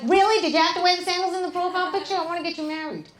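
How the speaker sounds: high, loud, fast nagging mother. Acted. Jesus's mother nagging him about his profile picture, shrill scolding.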